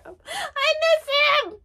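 very high wail of longing; real emotional wail. Real, not acted. Fan missing Pedro, high emotional wail. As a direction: cry it out high, overcome with missing him.

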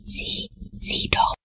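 slow, fully voiceless whisper; hushed, drawn-out urgency. A narrator's reading. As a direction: whisper to your friend to look. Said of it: "flüsterte Tom".

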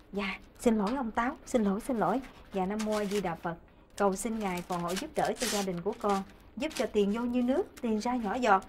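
soft, low prayer voice; praying. Acted. Wife praying to the Kitchen God for her household, softly praying. Resumes prayer after interruption.

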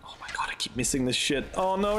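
ordinary delivery

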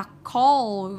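slow "call" with a lengthened vowel; long O. Shown as a demonstration. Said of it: "ba thằng này đều là O".

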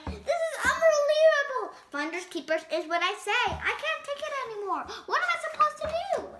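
frantic pigeon. Acted. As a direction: come apart at the seams.

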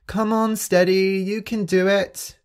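high cheering call; shouting encouragement. Acted. The animals cheering Steady on, high and loud. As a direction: cheer her on, pitched high. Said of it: "the other animals were shouting".

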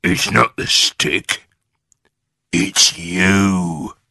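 slow, gloating character voice; drawn-out, nasty taunt; Mr Twit grinning horribly. A narrator's reading. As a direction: say it through a horrible grin. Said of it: "grinning horribly".

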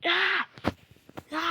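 high, very loud cry; a furious gamer's yell; frustrated yell. Real, not acted. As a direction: scream at the screen. Furious at the game.

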